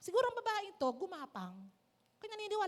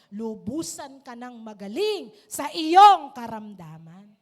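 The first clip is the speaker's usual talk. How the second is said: put-on building, louder blessing to a loved child; Jesus blessing; 13 dB louder than usual. Jesus sending the healed woman home, a swelling blessing. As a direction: bless her, swelling to a loud close.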